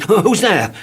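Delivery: high frantic terrified cry; panicked shout. Acted. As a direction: wail in comic terror. Mister Jelly fears a snake.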